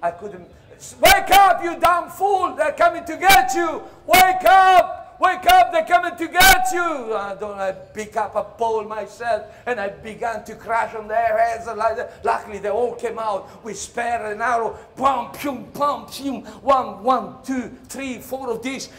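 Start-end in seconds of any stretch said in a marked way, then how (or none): 1.0-7.1 full yell, much higher and louder; yelling at a sleeping fool; shouted warning. Retold or imitated. 15.0-16.4 punchy pum pum; loud imitated blows; mock impact sounds.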